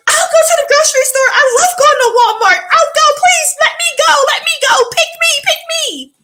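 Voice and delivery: hammed-up eager helper begging to serve; eager people-pleaser. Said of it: "I was the send me, I'll go person".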